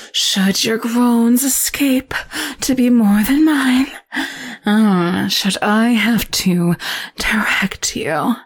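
menacing temptress toying with her captive; sultry seductress. Acted. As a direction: purr each threat like a caress. Seductive captor teases hero.

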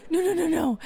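ordinary delivery

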